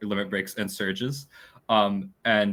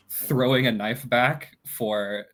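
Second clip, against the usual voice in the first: ordinary talk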